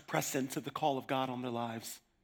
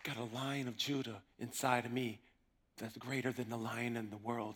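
soft emphatic repeat; 4 semitones lower, 9 dB quieter than usual. Acted. Repeats line softly for emphasis.

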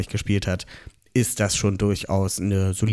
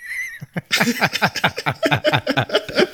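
high burst of laughter; 14 semitones higher, 3 dB louder than usual. Real, not acted. Laughing at the odd phrase.

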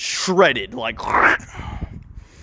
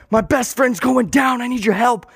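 urgent plea; 9 semitones higher than usual. Acted. Calling for help, friend shot.

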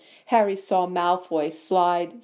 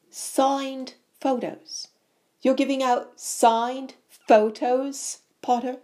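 slow, higher-pitched mocking disbelief; scathing; 7 semitones higher, slower than usual. A narrator's reading. Bully catches rival giving out photos, scathing. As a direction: draw out the words with scorn. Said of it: "Loud and scathing".

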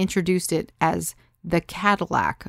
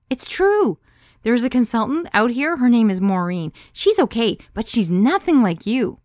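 high, loud, insistent praise; enthusiastic; 6 semitones higher, 6 dB louder than usual. A narrator's reading. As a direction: gush loudly to convince her. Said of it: "Sarah said enthusiastically".

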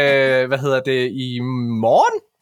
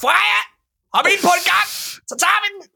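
high-pitched, hammed-up calling out; squealed comic urgency; mock horny summons; 14 semitones higher, faster than usual. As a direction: call her over in overexcited mock lust. Acts calling his pregnant partner to sex.

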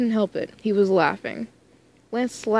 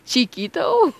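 Chiquito belted out full, higher and louder, breaking into a laugh; with my whole chest; 5 semitones higher, 4 dB louder, slower than usual. Shown as a demonstration. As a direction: commit fully to the grandma's Chiquito. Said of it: "I really said with my whole chest".

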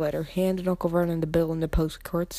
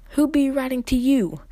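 loud, high sneering taunt; 7 semitones higher, 7 dB louder than usual. A narrator's reading. Uncle sneering at Harry over who writes to him. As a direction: scoff at the boy. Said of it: "sneered Uncle Vernon".

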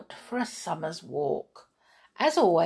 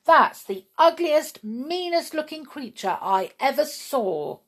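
rude comment; 8 semitones higher, 4 dB louder than usual. Acted. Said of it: "make a rude comment".

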